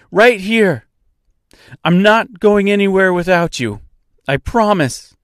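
loud call across water. Acted. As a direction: shout it over the noise. Calling across the river.

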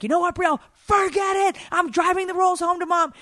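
high, fed-up imitated yell; hammed-up teen shouting match; mock angry shout. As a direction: storm off with the rolls, mock fury. Imitating daughter yelling on phone.

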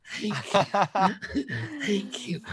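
high, sighing, laughing thank-yous; overwhelmed actor thanking Spielberg, laughing; breathless laughing thanks. Retold or imitated. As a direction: sigh out your thanks through nervous laughter. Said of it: "I was so excited".

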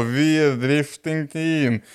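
very slow, stretched mock drunk claim; drawn-out drunk boast. Drunk man confirms his boast to kids, slow drawl. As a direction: drag it out tipsily.

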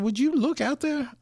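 high-pitched retold disbelief; incredulous, acting out astonishment; amazed. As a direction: ask it in utter amazement, voice lifted high. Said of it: "I looked at him, you know, with just amazement".

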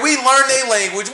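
normal talking voice

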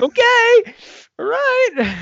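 hammed-up nervous yelp; mock panic. Diner watching the dynamite kid, hammed-up nervous squeal. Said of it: "It's like".

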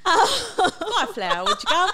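high, loud, hammed-up Cockney; a cheeky mock Cockney flower seller, laughing; bad Cockney accent. Director teasing the podcast cast with a bad Cockney accent. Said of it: "Not a very good Cockney accent".

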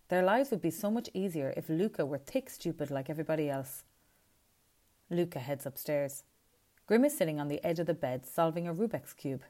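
usual speaking voice

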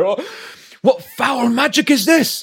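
high, loud, slow heroic outcry; theatrical high cry of alarm; aghast warrior. Acted. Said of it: "really raise my axe and be like".

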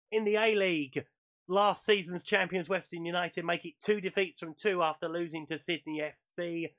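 radio sports roundup read; news read. Acted. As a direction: deliver the results like a bulletin. Reads the weekly football roundup.

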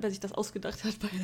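usual speaking voice

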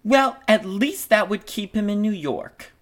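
high-pitched stage reply; piping character voice; [name]'s character voice. Acted. [name] answers Dora in scene.

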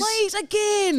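high-pitched mock plea; squeaky, hammed-up pleading; begging kid. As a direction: beg like a child wanting a repeat. Echoes the child's plea.

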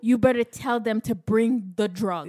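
mock fierce demand. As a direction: grab him and demand it. Grabbing husband by the neck.

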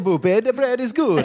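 high-pitched, hammed-up Italian accent; bad Italian accent. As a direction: put on a silly Italian accent. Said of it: "gonna do a bad accent".

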